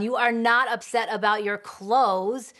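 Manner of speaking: loud, stress on not, dismissive; denying. Retold or imitated. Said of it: "So we deny the feeling".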